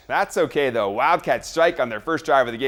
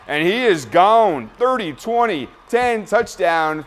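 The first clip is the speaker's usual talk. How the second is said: raised, drawn-out countdown to the end zone; excited play-by-play; 5 semitones higher, 5 dB louder, slower than usual. Real, not acted. Host calling Herdia's touchdown run, excited play-by-play. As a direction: call the breakaway run, high and slow.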